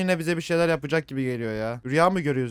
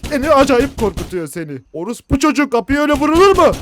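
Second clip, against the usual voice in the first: much higher, loud startled shouting; real frightened yell; 10 semitones higher, 13 dB louder than usual. Real, not acted. Gamer jolted by banging door, shouting curses.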